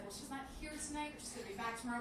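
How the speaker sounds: a hint of a sheepish boyfriend; hushed explanation. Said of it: "He's like".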